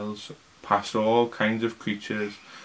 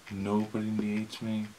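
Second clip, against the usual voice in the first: ordinary talk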